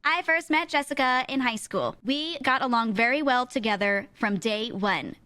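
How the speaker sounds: mock stilted singsong recitation; wedding-speech reading tone. Girl's speech at friend's wedding, mocked reading tone. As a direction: recite it like a rehearsed speech read off paper. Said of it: "the speeches that girls give to their friends at weddings".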